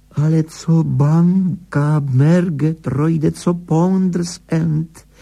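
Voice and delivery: high, slow, drawn-out disbelief; baffled protest. A narrator's reading. Higgins cannot grasp the director quitting, baffled protest. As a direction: stretch every word in high-pitched bewilderment. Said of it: "Higgins to prostě nechápal".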